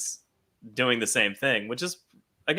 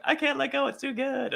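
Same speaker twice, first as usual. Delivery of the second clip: high mock Nynaeve; 11 semitones higher, 3 dB quieter than usual. Voicing Nynaeve in retold scene.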